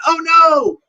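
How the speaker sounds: high, loud hammed-up oh no; mock alarm. Storyteller voicing the orcs' dismay as the ring is lost, mock alarm. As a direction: gasp it out like a dismayed orc.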